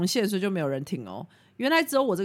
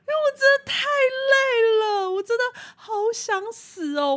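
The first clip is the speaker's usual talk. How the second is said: high, wailing fake cry, words breaking; hammed-up retelling of crying alone in the car; mock sobbing; 6 dB louder than usual. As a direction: whimper it like a melodramatic sob. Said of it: "然后就在车上哭".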